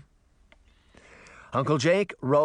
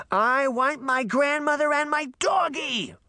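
put-on high, shouted childish demand; 14 semitones higher than usual. A narrator's reading. Mad girl demanding her family, shrill shout. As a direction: scream your wish.

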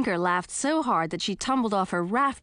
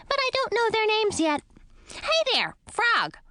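bat voice. A narrator's reading. Bat character voice.

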